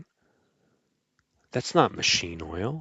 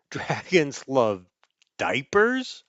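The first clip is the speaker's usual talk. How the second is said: laughing disbelief; 3 semitones higher, slower than usual. Acted. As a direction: giggle out the silly title in disbelief. Laughing retelling of the wrong title.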